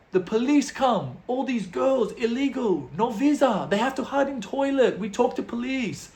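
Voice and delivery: high, pleading broken English; imitated foreign fixer. Club fixer excuses missing girls to tourists, high pleading.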